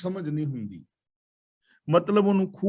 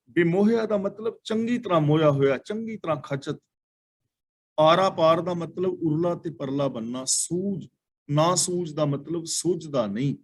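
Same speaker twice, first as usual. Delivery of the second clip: normal talking voice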